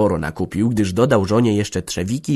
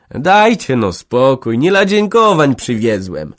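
loud, slow fending-off; louder, slow cry to stop their thanks; protesting call; 5 semitones higher, 7 dB louder, slower than usual. A narrator's reading. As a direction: wave their thanks away loudly. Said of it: "wołał broniąc".

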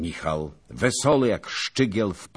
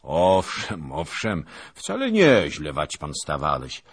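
louder, condescending praise; patronizing; 7 dB louder than usual. A narrator's reading. Zagłoba patronizing the Lithuanian knight, loud and condescending. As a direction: pat him on the head with words. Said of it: "mówił protekcjonalnie".

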